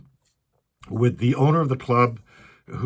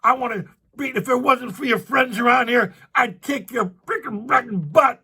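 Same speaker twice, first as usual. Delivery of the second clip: retold screaming; 10 semitones higher than usual. Angry stranger threatens the comic outside the club, mock yell. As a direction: shout it like he's about to swing. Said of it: "He's, like, screaming at him".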